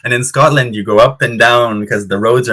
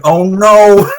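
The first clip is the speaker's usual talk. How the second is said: a higher, louder mock oh no; retold dismay; 9 semitones higher, 6 dB louder than usual. Watching a seagull steal a boy's ice cream, mock dismay. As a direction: cry out in pity for the boy. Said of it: "and I was like".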